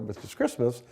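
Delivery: normal talking voice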